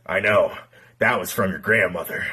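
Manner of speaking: deadpan low punchline; grandfather voice. Acted.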